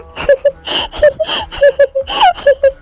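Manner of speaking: high-pitched laughing fit; crazy laughter. Acted. Woman hearing her husband called a father, crazy laughter. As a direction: laugh like mad, high and helpless. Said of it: "पागलों की तरह क्यों हंस रहे हो".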